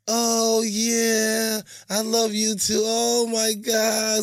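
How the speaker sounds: mock forced gushing lady. Mocking how a lady fakes I love you too. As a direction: overdo a fake swoon, too sweet to mean it. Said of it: "when the lady be like".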